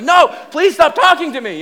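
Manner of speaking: high, loud, exasperated begging; panicked plea. Retold or imitated. Scared rider begging the pastor to stop talking, panicked plea. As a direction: beg at full voice to be left alone. Acted plea to companion on tower.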